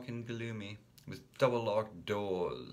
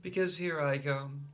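high playful threat; girl's mock snarl; 7 semitones higher, 4 dB louder than usual. A narrator's reading. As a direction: charge in with pretend menace. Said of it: "she snarled".

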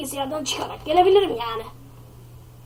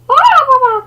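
high, loud surprised cry; a boy caught off guard; startled kid; 9 semitones higher, 9 dB louder than usual. Acted. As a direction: kid yelping to dad.

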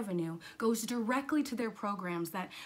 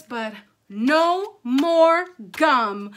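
NO MORE GUM loud and very slow, each word separate; drawn-out, dramatic motherly verdict; stern decree; 4 semitones higher, 15 dB louder, much slower than usual. Acted. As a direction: lay down the law word by word. Mother lays down the law.

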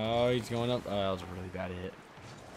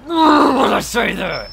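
shout; 19 dB louder, slower than usual. Real, not acted. Sudden outburst at own remark.

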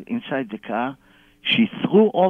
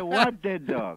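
mock-baffled question; feigned ignorance; 3 semitones higher, 4 dB quieter, slower than usual. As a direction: play innocent, over the laughter. Feigned ignorance about the dead dog.

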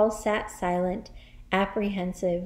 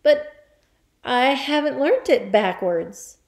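put-on higher, hesitant boy's voice; stammered; 5 semitones higher, 6 dB louder than usual. Boy protests to the headmistress, stammering. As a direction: falter after the first word, then protest. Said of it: "stammered Wilfred".

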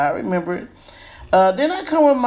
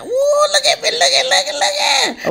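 excited squeal. Real, not acted. Showing off her finished swoop.

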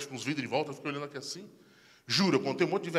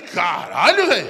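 loud swoop up in comic shock; stunned exclamation; 7 dB louder, much slower than usual. Retold or imitated. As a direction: stretch it out, rising in disbelief. Espanto ao ver os velhos nus. (palavrão espantado, esticado e subindo)

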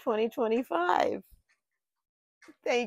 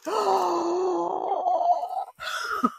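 tearful gasp; 11 semitones higher than usual. Real, not acted. Moved to tears by gift.

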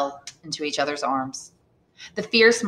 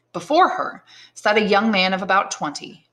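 ordinary delivery, nothing marked

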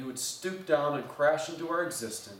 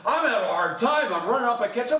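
breathless chase; 9 semitones higher, 6 dB louder, faster than usual. Retold or imitated. Nephew chasing uncle downhill.

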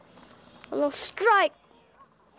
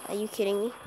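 low, soft disbelief; muted disbelief; 4 semitones lower, 6 dB quieter, much faster than usual. Real, not acted. Player stunned by the game, muted disbelief. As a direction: say it under your breath, stunned. First stunned take, then outburst.

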